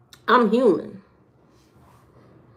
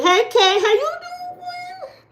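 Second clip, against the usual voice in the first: very high, slow squeaky hello; high-pitched greeting; slower than usual. Acted. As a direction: stretch it out in a tiny high voice.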